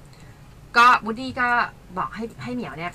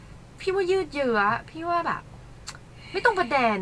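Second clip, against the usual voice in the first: 1 mock critical interpreter; 6 semitones higher than usual. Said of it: "เขาก็แบบ".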